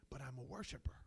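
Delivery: sudden whispered confession. Acted. Preacher confiding to his church, whispered. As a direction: drop to a breathy hush.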